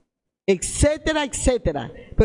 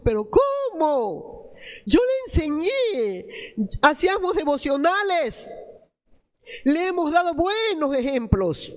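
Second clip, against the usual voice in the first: dismayed parent; 5 semitones higher than usual. Acted. Voicing parents' dismayed protest.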